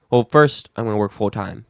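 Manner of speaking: ordinary talk, nothing marked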